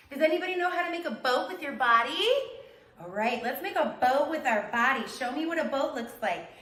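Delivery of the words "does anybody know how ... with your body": usual speaking voice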